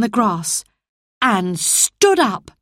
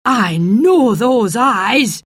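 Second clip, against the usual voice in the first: put-on old gardener Ben; 4 semitones higher, 4 dB louder, slower than usual. Ben recognises the boy.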